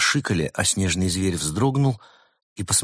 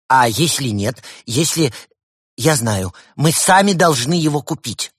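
excited outburst; 6 semitones higher, 6 dB louder than usual. Acted. Evgeny's emotional outburst.

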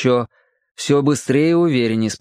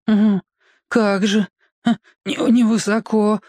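higher, mocking, stumbling; sarcastic thought; 9 semitones higher than usual. A narrator's reading. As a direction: Petka scoffing to himself. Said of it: "думал Петька". (ехидно, с запинкой)

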